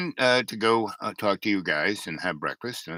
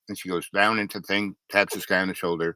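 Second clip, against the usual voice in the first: ordinary delivery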